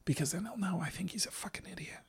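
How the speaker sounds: mock mom whisper. Host mocking co-host while he fetches water, mock-whispered mom monologue. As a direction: whisper a mock mom's inner monologue to the mic.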